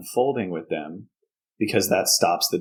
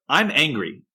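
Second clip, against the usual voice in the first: toned-down angry yell; 6 semitones higher than usual. Shown as a demonstration. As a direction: still angry, short of a full yell. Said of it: "instead of, like".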